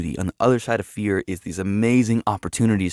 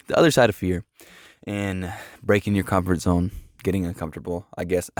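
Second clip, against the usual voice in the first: ordinary talk, nothing marked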